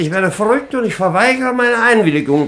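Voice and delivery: high, loud indignant protest; indignant outcry. Acted. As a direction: wail your refusal in outrage. Baron protesting the marriage. (empörter Aufschrei, hoch und laut)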